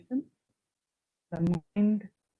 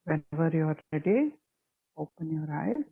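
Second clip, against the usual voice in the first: guided meditation. Real, not acted. Teacher leading students through breath and affirmation, calm guided meditation. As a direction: guide them gently into stillness, unhurried and even.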